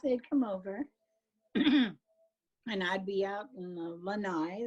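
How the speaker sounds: normal talking voice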